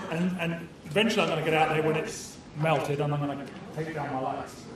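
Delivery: normal talking voice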